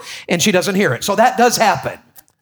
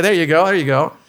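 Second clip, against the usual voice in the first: usual speaking voice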